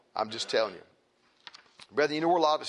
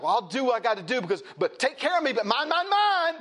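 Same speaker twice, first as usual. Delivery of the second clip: high, whiny mock of a selfish person, climbing on mine; mocking greedy voice; 9 semitones higher, 5 dB louder than usual. Preacher mocking selfish people to congregation, whiny high voice. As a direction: sneer it out like a spoiled child grabbing. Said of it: "And it's like".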